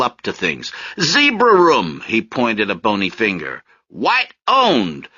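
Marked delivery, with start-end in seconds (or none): 1.0-2.0 soapbox preacher. Acted. Marius preaching on street corner. 3.9-5.1 soapbox preacher. Acted. Marius preaching on street corner.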